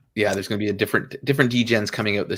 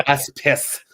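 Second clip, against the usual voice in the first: badly faked Irish accent, higher and louder; horrible Irish accent; 5 semitones higher, 7 dB louder than usual. Shown as a demonstration. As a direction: attempt an Irish accent and miss. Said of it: "that was a horrible Irish accent".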